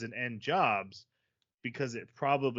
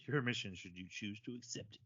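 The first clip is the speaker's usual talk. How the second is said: low, hushed spy-briefing voice; very soft, low mock-official briefing; secret mission briefing; 4 semitones lower, 12 dB quieter than usual. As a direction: deliver it like a covert recorded message. Quotes a famous spy briefing.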